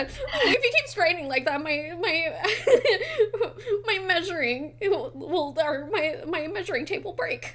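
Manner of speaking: high, laughing voice through the line; giggling fish lady. Acted. Fish tailor teases the flexing player, giggling high. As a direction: keep breaking into giggles as you tease. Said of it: "she says".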